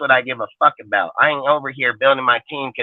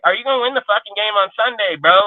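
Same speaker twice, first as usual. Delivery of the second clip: higher, fast, demanding voice; a higher, fast mock challenge to a player; challenging a player; 8 semitones higher than usual. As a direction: call him out, quick and pushy. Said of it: "I look at the guy like".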